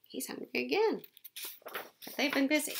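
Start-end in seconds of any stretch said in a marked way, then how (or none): none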